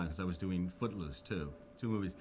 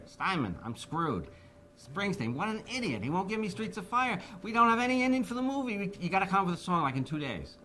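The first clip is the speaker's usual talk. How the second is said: higher, louder mock panic, speeding up; 9 semitones higher, 3 dB louder than usual. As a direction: rush the plea in a hammed-up panic. Imitating [name] panicking.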